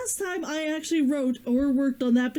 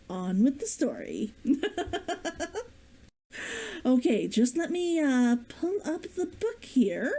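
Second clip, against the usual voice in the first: usual speaking voice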